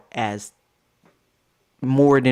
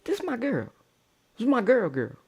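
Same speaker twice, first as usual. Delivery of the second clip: exasperated; 9 semitones higher, 3 dB quieter, faster than usual. Retold or imitated. Exasperated pleading.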